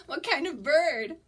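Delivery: laughing through the correction. Real, not acted. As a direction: chuckle at your own mistake. Laughs at own slip.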